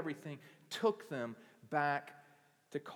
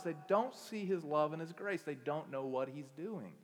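ordinary delivery

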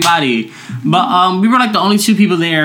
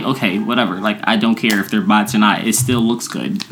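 low, softer unbothered retort; acted indifference, pitched down; dismissive; 8 semitones lower, 4 dB quieter than usual. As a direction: brush off the insult, voice dropped. Retold brush-off of an insult.

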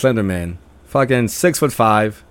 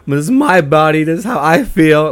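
higher-pitched retold outburst; indignant, replaying the quarrel; heated protest; 6 semitones higher, 3 dB louder than usual. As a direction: insist on it like you're mid-argument. Retold argument over bandage.

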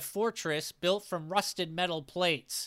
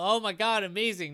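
a louder, higher burst of retold delight; excited outburst; 4 semitones higher, 4 dB louder than usual. Players cheering a lucky save, excited. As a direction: cheer it like the save just landed. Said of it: "They go".

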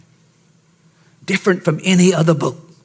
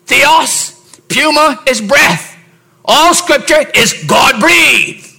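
slow, louder, higher sermon voice; slow, high preaching; 8 semitones higher, 4 dB louder, slower than usual. Acted. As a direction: proclaim each word slowly. Preacher explaining a Greek word.

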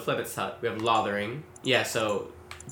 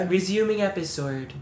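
higher, lightly British "Resuming episode"; mock British app voice; 6 semitones higher than usual. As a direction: echo the app lady's phrasing. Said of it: "it sounds like resuming episode".

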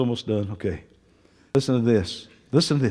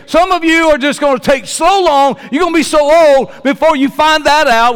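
high, loud preacher's cry, slowed on the body of Christ; preaching at full force; 9 semitones higher, 7 dB louder than usual. Acted. Preacher tells the church there's no solo faith, full-force preaching. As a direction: lift it up and drive it home to the congregation. Preaching against loving the world.

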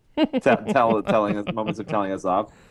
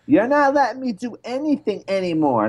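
put-on light New York accent, much higher; mock a little girl's New York whine, lightly; kid's New York accent, half-hearted; daughter's New York accent; 11 semitones higher than usual. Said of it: "did she say it with that accent".

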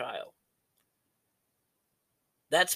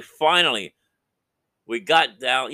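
finally, it's out, said with relief; relieved God; 3 semitones higher, 8 dB louder, slower than usual. Retold or imitated. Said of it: "God's like".

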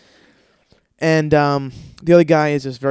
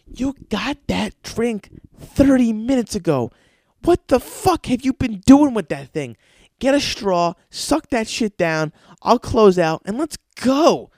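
higher, slower, louder exasperated nagging; to fiancee at lunch, a higher louder impatient nag; impatient rant; 9 semitones higher, 5 dB louder than usual. Retold or imitated. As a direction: hurry her along, fed up. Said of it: "I'm just like".

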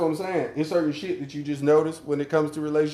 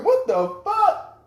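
much higher, slow stunned what the fuck; mock shocked reaction; much slower than usual. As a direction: stretch it out in stunned disbelief. Retells own shocked reaction.

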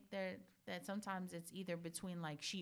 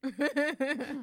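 much higher and louder, laughing admission; loud confession; 9 semitones higher, 15 dB louder than usual. Real, not acted. Mom owning the blame to her friend, loud and laughing. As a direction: blurt it out, high and loud.

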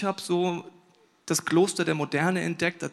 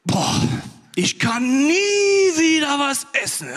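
louder, higher, slow, moaning exclamation; overstuffed groan-shout; 8 semitones higher, 7 dB louder, much slower than usual. Retold or imitated. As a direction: wail it like you've eaten ten courses. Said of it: "sag ich so Sätze wie". (lautes, gedehntes Stöhnen nach dem Festessen)

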